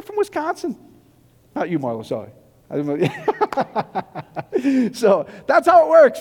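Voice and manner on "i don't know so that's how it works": dissolving into laughter, drawn out; laughing. Real, not acted. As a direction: laugh your way through it, slow and louder at the end. Cracking up at own joke.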